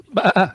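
goat bleat. Retold or imitated. Goat interrupting the prank message, bleating.

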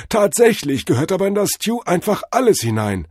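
high, loud divine announcement; proclaiming. A narrator's reading. God proclaims the stew rule to Cordell, proclaiming. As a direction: announce it like a revelation. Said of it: "verkündete Tod Hermes".

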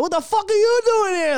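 high, loud imitation of an offended local; mock outraged challenge. Boston local to a Charlestown outsider, mock outrage. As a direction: confront the outsider, hammed-up.

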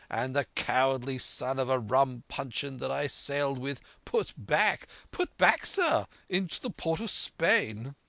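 high, fervent, blustering squire; excitable squire. Acted. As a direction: rave about a fearsome pirate, high and emphatic. Voices the squire raving about Flint.

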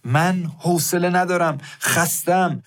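hammed-up angry husband snapping back; retold shout. Husband Masoud yelling at wife Saeedeh, a mock shout.

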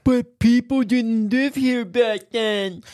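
slow, high, droning lecturer voice; professor impression. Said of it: "that was my impression of a professor".

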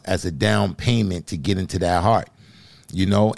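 usual speaking voice

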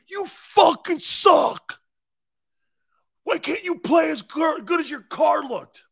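high, loud, slow jeering shout; heckler yell. Retold or imitated. Angry fan screaming insults at the player, high jeering shout.

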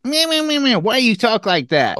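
high, jeering mimicry of someone's voice; mocking. Bartender mocking a customer's high voice, jeering. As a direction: imitate a bartender making fun of a customer. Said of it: "she immediately mocked me".

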